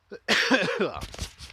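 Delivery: harsh loud cough from the cold. Real, not acted. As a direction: cough hard. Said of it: "I'm freezing".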